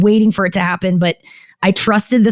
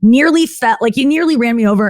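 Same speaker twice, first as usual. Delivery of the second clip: ordinary delivery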